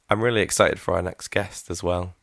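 ordinary talk, nothing marked

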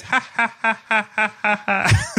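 high, repeating canned ha-ha like a laugh box; mechanical laugh. Retold or imitated. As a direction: play a wind-up laugh machine, evenly spaced. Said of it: "a machine next to you that goes".

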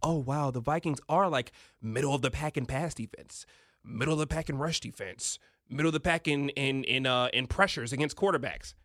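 mocking analysts. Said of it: "we say".

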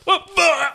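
high squeaky dying yelp; a cartoon death squawk; mock death cry.

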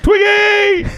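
high shout calling a pet. Acted. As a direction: call her name across the pool. Calling the squirrel.

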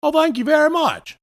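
very high-pitched mock British voice; put-on British accent. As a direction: squeak out thanks in a hammed-up British accent. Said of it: "South Carolinian accent".

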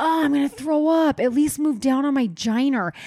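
high, loud mid-crunch outburst; retold loud complaint. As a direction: call out as if over loud headphones. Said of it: "talking so loud".

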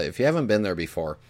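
usual speaking voice

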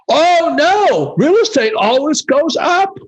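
high, silly put-on voice of a naive buyer; mock naive couple. Imitating a naive young couple at a party, high and silly.

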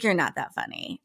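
condescending. Retold or imitated. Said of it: "real condescending".

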